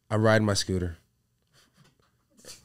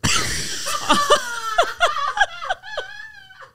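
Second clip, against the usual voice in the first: high screaming burst of laughter; shrieking laugh. Real, not acted. Huge laugh after the joke.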